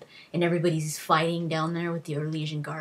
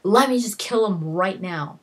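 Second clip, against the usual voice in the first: indignant retold outburst, higher pitched; mad; 4 semitones higher, 4 dB louder than usual. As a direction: act out an angry confrontation, pitched up and pressing. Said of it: "Logan gets mad".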